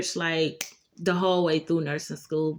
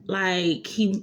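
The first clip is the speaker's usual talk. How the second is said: ordinary delivery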